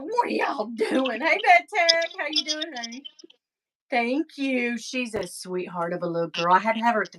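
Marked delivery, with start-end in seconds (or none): 0.0-3.0 voicing the dog. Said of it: "She's like".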